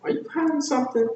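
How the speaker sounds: high-pitched, quick nosy sidekick; pry in a squeaky, hurried voice; very high prodding question; Donkey. Acted. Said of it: "And he said".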